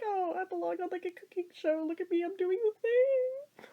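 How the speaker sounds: high, soft sing-song boast, climbing at the end; mock show-off. Cook feeling like a cooking-show star, mock show-off. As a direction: playfully imitate a proud TV cook.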